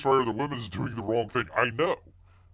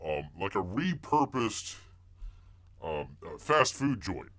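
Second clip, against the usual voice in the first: usual speaking voice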